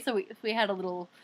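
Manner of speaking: ordinary delivery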